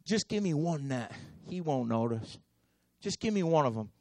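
soft, low, half-hearted bargaining; lightly voiced stingy fisherman; grudging Simon. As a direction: hold back like a reluctant fisherman. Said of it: "He does like a lot of us do".

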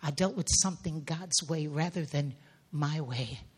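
ordinary delivery

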